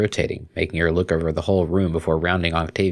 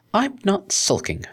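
higher, slow, muffled face in pillow; 13 semitones higher, slower than usual. A narrator's reading. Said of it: "said her muffled voice".

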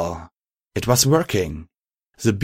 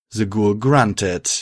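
ordinary delivery

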